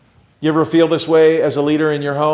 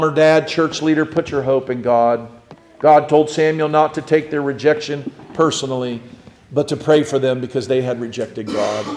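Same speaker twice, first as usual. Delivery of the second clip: usual speaking voice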